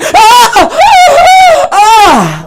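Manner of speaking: high laughing shrieks; surprise orgasm cry. Retold or imitated. Comic demo of the sound she made. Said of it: "It was more like".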